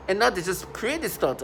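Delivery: ordinary talk, nothing marked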